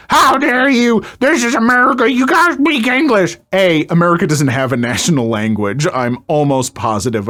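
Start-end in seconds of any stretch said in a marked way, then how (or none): 0.1-3.4 higher, shrill-free mock outrage in a put-on voice; mocking Karen. Narrator mocking Karen's rant, high put-on indignant voice. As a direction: ham up the entitled shopper. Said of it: "be like".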